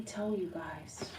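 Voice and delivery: confiding whisper. Real, not acted. Reader confides to viewers in a whisper. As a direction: lean in and whisper it.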